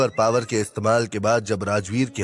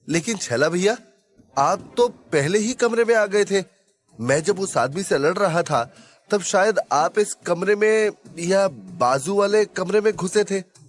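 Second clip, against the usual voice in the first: higher-pitched, puzzled character voice; questioning companion; 6 semitones higher than usual. Acted. Companion asking Chhaila which room he entered, questioning. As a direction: question him in a raised voice.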